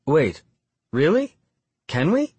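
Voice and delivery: high, rising, hopeful questions; excited. A narrator's reading. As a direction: lift the pitch sharply in eager surprise. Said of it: "I can hear the excitement in her voice".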